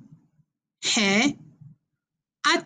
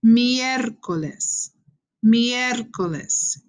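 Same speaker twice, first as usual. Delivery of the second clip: miércoles spelled out slowly, syllable by syllable; teacher slowing down the hard word for students; slow syllable demo; much slower than usual. Shown as a demonstration. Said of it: "that's the hardest one".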